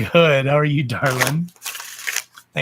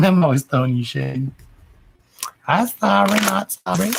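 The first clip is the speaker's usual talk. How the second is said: teasing; 5 semitones higher, 3 dB louder than usual. Real, not acted. Teasing a viewer playfully.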